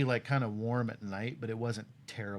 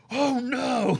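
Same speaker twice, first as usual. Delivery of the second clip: high loud mock dismay; dismayed cop; 11 dB louder than usual. The cop seeing vomit in his car, high dismayed squeal.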